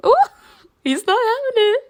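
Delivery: high crying squeal of mock pity. Real, not acted. Squealed repeat of own comment.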